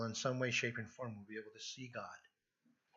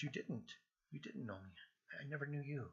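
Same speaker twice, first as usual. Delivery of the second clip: quiet rebuttal; 2 semitones higher, 9 dB quieter than usual. Acted. Jesus answering those who claim him, quiet rebuttal. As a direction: answer the plea softly and firmly.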